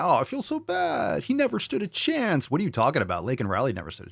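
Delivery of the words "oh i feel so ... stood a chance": high mock-pitying voice, slowed; mocking sympathy. Streamer mocking the headline in falsetto pity. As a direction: sneer a fake pity in a falsetto. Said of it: "this is from a headline".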